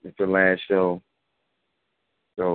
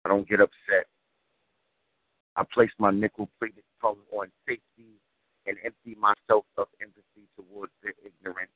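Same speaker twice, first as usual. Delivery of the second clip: rhythmic, weighty poem recital; spoken word poetry; 4 semitones higher, 3 dB louder than usual. Acted. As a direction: deliver it like a slam poem.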